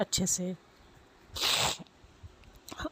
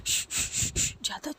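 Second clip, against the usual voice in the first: unvoiced hushing shh shh; shushing; 7 semitones higher, 3 dB quieter than usual. Sneha hushing the sobbing girl on the road, whispered shh. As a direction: hush her quietly, breathy shh.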